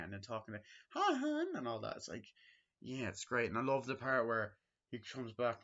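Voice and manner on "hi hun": high, sweet mock-Wendy greeting; imitating Wendy. Wendy greeting her husband in a high sugary voice. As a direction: play up her eager wife voice.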